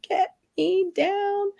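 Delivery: high, drawn-out mock plea; panicked plea. Stranded skier begs to get down, mock panic. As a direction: stretch each word in a high, pleading wail.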